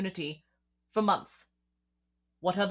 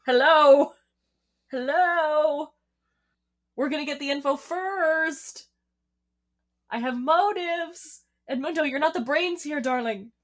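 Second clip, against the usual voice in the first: much higher and louder sarcastic shouting; exasperated yell; 8 semitones higher, 7 dB louder than usual. Pretend. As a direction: yell the way she wishes she could. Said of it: "I can't yell at Edmundo".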